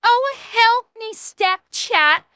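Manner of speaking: high, slow, full-voiced mock plea; meme damsel plea. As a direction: ham up a drawn-out cry for help. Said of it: "I'll do the end of the meme".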